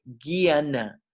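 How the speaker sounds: "guiana" with a hard G, gui-a-na. Shown as a demonstration. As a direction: say "guiana" slowly, opening on a hard g. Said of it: "se pronuncia como si fuera una G".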